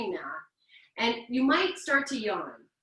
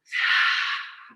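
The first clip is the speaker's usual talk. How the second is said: yawning sigh; 5 dB louder than usual. Shown as a demonstration. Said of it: "calling in breath with a wide open mouth".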